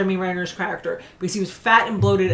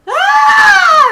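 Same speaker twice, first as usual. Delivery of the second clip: outraged shriek; 13 dB louder than usual. Real, not acted. Insulted on friend's behalf.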